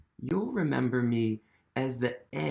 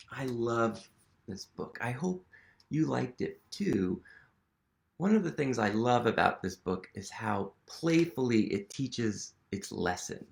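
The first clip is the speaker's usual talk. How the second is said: usual speaking voice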